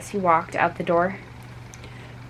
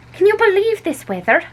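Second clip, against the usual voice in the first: Fiona's voice; 12 semitones higher, 4 dB louder than usual. A narrator's reading. Fiona complains about weather.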